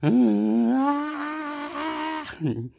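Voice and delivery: long, high laugh held out; acted crazy laugh. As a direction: let a manic laugh rise and stretch. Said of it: "I went".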